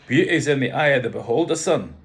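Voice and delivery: loud, commanding Scottish father; rough Scottish accent. A narrator's reading. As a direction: size up the newcomer as a blunt Scot. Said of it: "Scottish, slightly rough".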